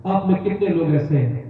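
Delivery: loud call to the congregation; rally the crowd to raise hands; preacher demanding a show of devotion; rousing preaching. Acted.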